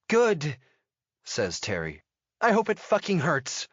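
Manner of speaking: high, pleased jab of a woman; angry woman's voice. A narrator's reading. Terry answers the intruder she hit with a high voice.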